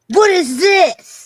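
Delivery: drawn-out, high, loud bewildered question; mock confusion at a strange accent, slowly; baffled outsider. Mocking how he'd react to the Midlands accent, drawn out in disbelief. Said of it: "because I'm like".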